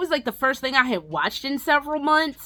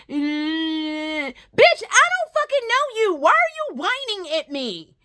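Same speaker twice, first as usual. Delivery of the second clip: slow, exasperated outburst, pitched up; 5 semitones higher, slower than usual. Real, not acted. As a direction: drag it out in disbelief. Exasperated rant at character.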